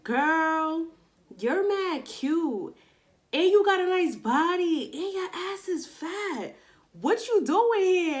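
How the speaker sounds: high, loud hyped dancer; loud mock flattering dancer. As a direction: gush at her like the club girl. Dancer voiced in retold story.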